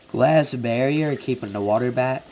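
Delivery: normal talking voice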